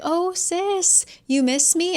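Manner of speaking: high, playful sibling tease; teasing brother. Retold or imitated. Brother casually greeting his furious sister, high teasing. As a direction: chirp it like a cheeky little brother. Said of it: "he's trying to be like".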